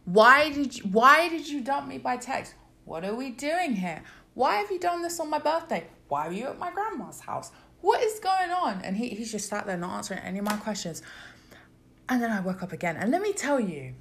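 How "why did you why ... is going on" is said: demanding answers from a silent man; questioning monologue. Retold or imitated. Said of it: "questioning monologue".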